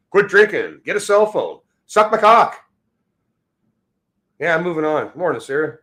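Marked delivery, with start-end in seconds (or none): 0.1-2.6 high, loud barked insults; angry shout. Real, not acted. Streamer shouting at a heckler in chat, angry. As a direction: shout him down.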